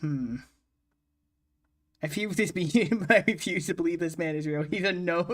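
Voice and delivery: ordinary delivery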